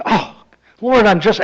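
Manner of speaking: sputter, too thrilled to finish; mock overwhelmed joy. Ferrari overwhelmed by his heaven house.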